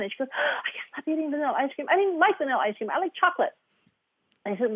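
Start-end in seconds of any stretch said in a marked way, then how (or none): 0.3-3.5 higher-pitched voice of a flustered client; retold panic. Client confessing an ice cream binge, mock panic. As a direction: imitate a client still in a panic. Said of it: "she was going in this panic".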